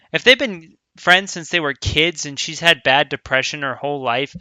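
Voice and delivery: ordinary delivery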